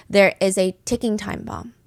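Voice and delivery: ordinary delivery, nothing marked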